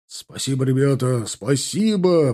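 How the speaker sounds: rising to a drawn-out thank you; calling out thanks. A narrator's reading. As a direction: call gratefully to the men, stretching the last word. Rider calls thanks to his rescuers. (благодарный, протяжный оклик)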